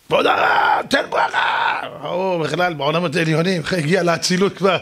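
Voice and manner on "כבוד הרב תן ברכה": rough, unpitched crowd roar; mock crowd shout. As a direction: clamour for a blessing like a fan. Crowd begs the rabbi for blessing. (צעקת קהל מחוספסת)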